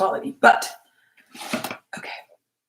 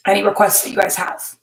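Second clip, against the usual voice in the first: ordinary talk, nothing marked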